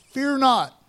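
loud, high scriptural command; bold proclamation. Acted. Preacher reads Isaiah to the church, boldly proclaiming. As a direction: ring it out over the congregation.

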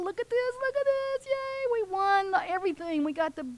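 put-on very high, giddy kids celebrating; gleeful victory shout.

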